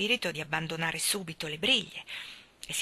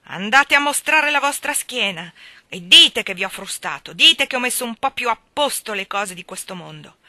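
loud, defiant jeer; irate taunt; 3 semitones higher, 10 dB louder than usual. A narrator's reading. Miller angrily taunting the beaten landlord. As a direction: gloat over him angrily.

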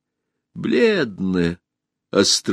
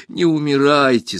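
higher, understated pleading cry; tearful plea; 6 semitones higher than usual. A narrator's reading. Alisa at the dying empress's side, mildly tearful. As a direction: plead lightly, as if starting to cry. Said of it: "заплакала она".

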